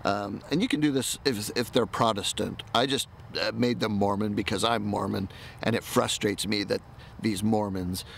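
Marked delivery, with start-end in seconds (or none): none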